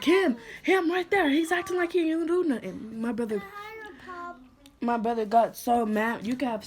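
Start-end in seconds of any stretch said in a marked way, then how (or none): 0.0-3.0 much higher, insistent pointing-out; urgent accusing. Retold or imitated. Girl points out the abuser to her brother, much higher and urgent. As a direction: jab at him: that one, right there.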